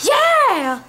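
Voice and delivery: high, loud whoop; excited shout. Real, not acted. Player capping the glasses chant, excited shout. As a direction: belt it out high.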